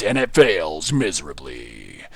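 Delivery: low, slow trailer announcer dooming the build; drawn-out dramatic trailer voice; movie trailer voice. Pretend. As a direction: announce the failure like a blockbuster teaser. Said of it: "I'm really loving the movie theater voice".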